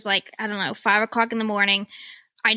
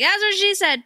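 high, pitched-up punchline; mock punchline; 9 semitones higher than usual. Patient quipping to surgeon, high pitched mock punchline. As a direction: squeak the catchphrase out through the clamps. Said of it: "I was like".

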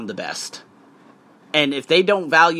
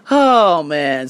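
ordinary talk, nothing marked

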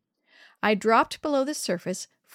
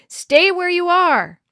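loud, high, drawn-out yell; urgent shout; 8 semitones higher, 11 dB louder, slower than usual. A narrator's reading. Father shouting from the water to his child, urgent yell. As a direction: shout a warning across the water, slowly. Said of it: "splashed back to the surface and shouted".

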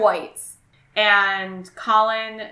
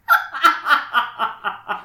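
real laughter; 4 semitones higher than usual. Real, not acted. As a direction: crack up after reading it out. Reader cracks up after the quote.

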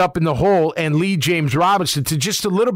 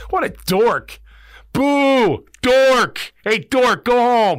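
mock jeering from the stands, louder and higher; booing fan; 5 semitones higher, 3 dB louder, much slower than usual. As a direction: heckle the coach like a fan in the seats. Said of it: "you're like".

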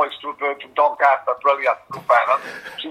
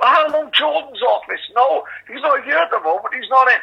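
higher, put-on secretary phone voice; posh phone voice; 9 semitones higher than usual. Mimicking his mate's posh office phone answer, higher pitched. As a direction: play the polite office receptionist. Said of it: "proper phone voice".

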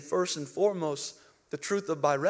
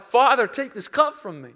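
raised, pleading call to the Father; retold cry, higher and louder; crying out in prayer; 4 semitones higher, 6 dB louder than usual. Jesus praying before the cross, crying out. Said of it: "He, he cries out".

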